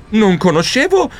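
ordinary delivery

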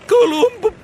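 Columb- jumps high and breaks off; broken off. Acted. The mouse reading the smudged diary breaks off high. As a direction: read Columb- high, stop dead. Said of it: "non riuscii più a leggere".

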